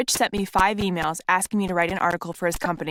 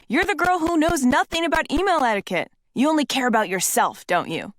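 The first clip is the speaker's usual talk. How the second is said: mocking Rich; 6 semitones higher than usual. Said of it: "he yelled".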